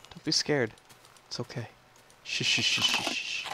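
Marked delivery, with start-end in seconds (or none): 2.2-3.5 soft whispered shushing. Real, not acted. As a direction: hush a frightened animal. Calming scared pet.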